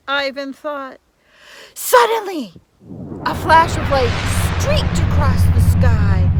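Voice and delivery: a lively read-aloud fairy tale voice; storybook narration. A narrator's reading. Reading a fairy tale to children, storybook narration. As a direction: tell it like a bedtime tale.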